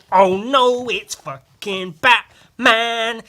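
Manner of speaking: oh no, milk the villain's arrival; dramatic gasp. Acted. Vlogger reading a comic to camera, hammed-up dramatic alarm.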